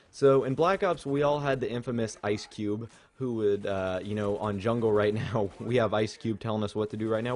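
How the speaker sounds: ordinary talk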